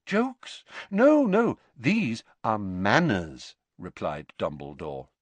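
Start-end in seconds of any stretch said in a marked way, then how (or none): 0.0-3.6 high questioning start, settling slowly; polite mock surprise. A narrator's reading. Dumbledore correcting Alecto on manners, mock surprised. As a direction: feign surprise, then correct courteously.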